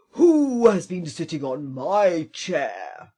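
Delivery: loud, slow booming bear; great big voice. Acted. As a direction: boom it out like a huge bear. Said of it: "he cried out in his great big voice".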